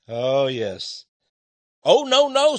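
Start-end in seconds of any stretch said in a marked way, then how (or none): 1.8-2.6 fly's refusal. Acted. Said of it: "said the little fly".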